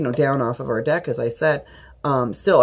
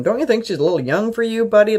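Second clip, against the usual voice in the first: teasing; 7 semitones higher, 3 dB louder, faster than usual. Retold or imitated. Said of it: "joking around with him".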